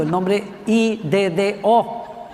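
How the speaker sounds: I D D O spelled letter by letter, slowly; spelling out the name. Shown as a demonstration. Spelling the prophet's name for the congregation, slow and amused. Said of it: "sino que es Ido".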